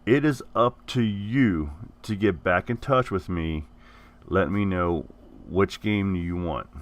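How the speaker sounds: usual speaking voice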